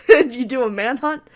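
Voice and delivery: laughing into the question; amused. Real, not acted. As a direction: ask it through a laugh. Amused at own guess.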